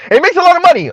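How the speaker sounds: high-pitched mock brag; mocking, high show-off voice; Kim Foster. Mocks Kim Foster's boasting.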